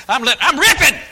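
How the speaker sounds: loud, high worked-up outburst; re-enacted anger. Preacher retelling his fit at the maid, re-enacted anger. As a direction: act out ripping into someone, voice climbing. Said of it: "I, I got angry".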